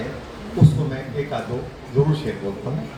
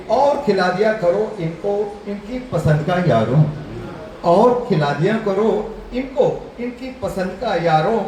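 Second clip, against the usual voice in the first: higher, emphatic verse declamation; poem recital; 5 semitones higher, slower than usual. Real, not acted. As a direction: declaim verse with ringing openings and falling closing lines.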